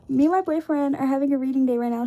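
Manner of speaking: talking with a retainer in; words slightly thick around a retainer; wearing a retainer. Real, not acted. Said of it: "I have my retainers in".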